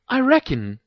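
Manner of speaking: high-pitched feigned innocence; innocent. A narrator's reading. Hermione teases Harry with a hint, innocent. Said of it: "blinked Hermione innocently".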